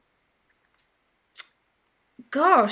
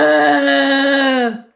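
loud, drawn-out undead groan; zombie moan; 6 dB louder than usual. Pretend. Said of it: "your best zombie impression".

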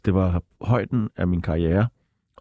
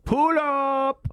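calling pull up. Shown as a demonstration. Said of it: "så kan vi sige".

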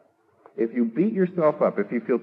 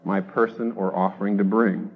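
louder, measured hymn recitation; reciting a hymn; 6 dB louder than usual. Acted. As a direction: declaim the old hymn with weight. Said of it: "John Newton says".

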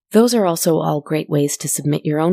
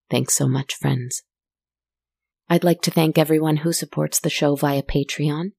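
normal talking voice

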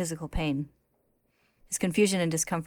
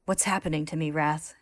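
usual speaking voice

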